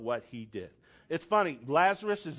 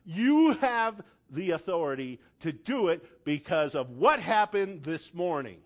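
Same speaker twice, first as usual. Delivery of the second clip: ordinary talk, nothing marked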